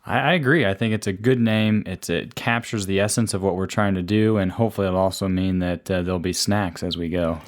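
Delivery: ordinary delivery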